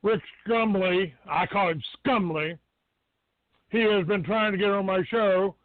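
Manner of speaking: normal talking voice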